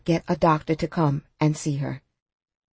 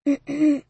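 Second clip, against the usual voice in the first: rough throat clear; 7 semitones higher than usual. A narrator's reading. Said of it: "clearing her throat".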